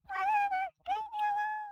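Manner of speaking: very high, loud brush-off; angry girl. Acted. Girl to Humberto, very high loud angry dismissal. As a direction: yell it away, high and loud.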